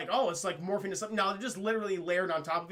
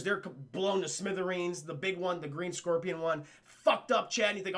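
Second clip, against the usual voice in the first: usual speaking voice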